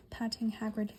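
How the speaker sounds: usual speaking voice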